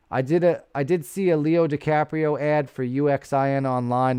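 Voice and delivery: ordinary talk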